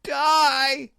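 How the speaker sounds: high, loud shriek of terror and fury; furious terrified screech. A narrator's reading. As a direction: shriek the word like a cornered witch. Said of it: "she shrieked in terror and fury".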